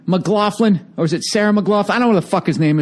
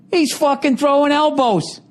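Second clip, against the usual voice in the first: usual speaking voice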